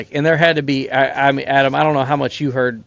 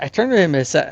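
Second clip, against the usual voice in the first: normal talking voice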